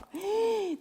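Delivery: high wailing gasp, acted; imitated animal-like wail. Recalling crying on the mountain at night, imitated anguished gasp. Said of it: "막 울부짖은 막 짐승처럼 막".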